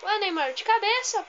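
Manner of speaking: high, loud reaction; excited protest. Real, not acted. Gamer reacting to Neymar's header, high excited shout.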